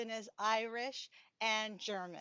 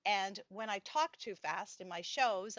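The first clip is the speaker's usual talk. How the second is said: usual speaking voice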